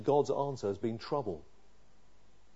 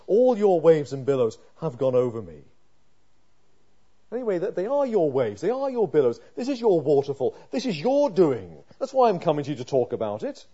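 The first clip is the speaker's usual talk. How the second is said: loud, urgent sermon voice; pleading with God on the psalmist's behalf; fervent preaching; 3 semitones higher, 8 dB louder than usual. Acted. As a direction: preach it out with full force.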